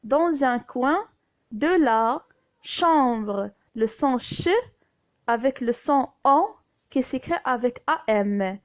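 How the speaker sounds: ordinary talk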